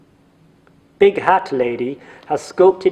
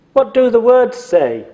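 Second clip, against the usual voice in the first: jogger voice; 9 semitones higher, 5 dB louder than usual. Acted. Jogger asks about sock.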